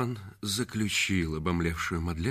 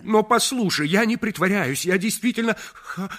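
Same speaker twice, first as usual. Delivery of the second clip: very high, loud, stuttering plea; frantic stammered proposal; stammering proposal; 14 semitones higher, 9 dB louder than usual. Acted. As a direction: trip over the words in desperation.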